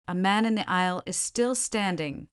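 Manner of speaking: ordinary delivery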